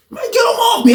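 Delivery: high yelled plea; shouting for help. Retold or imitated. Teller retelling calling for help mid-fight, high yell. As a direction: scream to get him pulled off.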